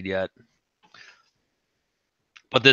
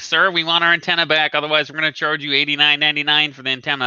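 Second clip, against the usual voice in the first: higher-pitched mock company rep; lightly mocked collections caller; demanding company rep; 5 semitones higher than usual. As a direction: imitate a rep making a formal demand. Mimics company demanding antenna back.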